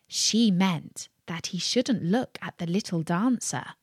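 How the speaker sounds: plain reading voice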